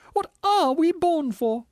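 high, loud, slow wail; despairing ghost. A narrator's reading. Ghost questioning the spirit, despairing wail. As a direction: cry it out in drawn-out despair.

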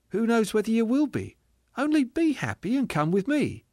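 high, lilting invitation; joyful spirit. Acted. Spirit inviting the ghost along, high and happy. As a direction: urge sweetly in a high voice.

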